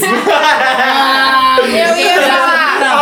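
laughing through the words; words broken by laughter. Real, not acted. Laughing while talking.

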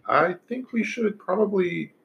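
lightly voiced cheerful halfling; a hint of a small homely halfling. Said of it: "You got the best halfling voice".

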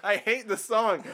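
high, loud, slow put-on grumble; heckler voice. Imitating Daniel as a heckler, high put-on voice. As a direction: sneer it like the crowd heckler. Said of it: "Daniel's like".

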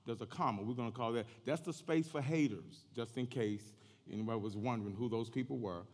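ordinary talk

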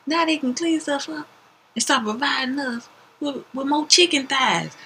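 high, slow goofy coworker voice; coworker's voice. Retold or imitated. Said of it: "he says".

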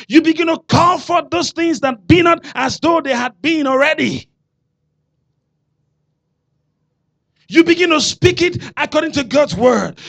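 loud, high, full-force preaching. Acted. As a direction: hammer each line home to the congregation.

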